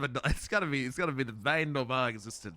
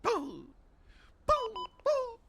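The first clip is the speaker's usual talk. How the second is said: playful boos climbing higher; mock ghost boo; much slower than usual. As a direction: spook someone with rising boos. Playful spooky boos, rising.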